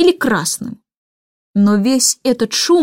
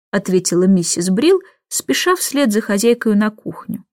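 plain reading voice

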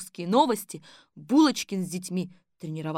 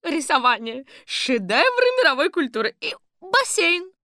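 high, loud energetic grandma; high cheerful granny; 9 semitones higher, 8 dB louder than usual. A narrator's reading. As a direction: list it brightly. Granny lists.

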